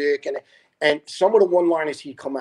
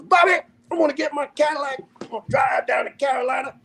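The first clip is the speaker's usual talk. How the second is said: Chicken John; 12 semitones higher than usual. Retold or imitated. Butcher shares his Christmas plans after a shot, high put-on character voice. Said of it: "the guy they call Chicken John".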